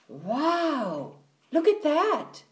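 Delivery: swooping, awestruck wow; high, wide-eyed exclamation; amazed wonder. A narrator's reading. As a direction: marvel at a sudden sight. Boy spots giant teddy, awestruck.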